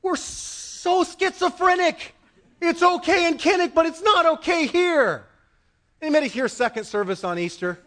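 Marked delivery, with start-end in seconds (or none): 0.0-5.2 high-pitched, indignant preaching; incredulous challenge. Real, not acted. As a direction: challenge the congregation on a double standard. Challenges congregation on double standard.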